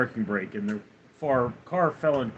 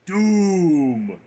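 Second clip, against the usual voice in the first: dramatic doom voice; 4 semitones higher, 8 dB louder than usual. Acted. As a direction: announce it like a movie trailer. Sound alert, dramatic boom.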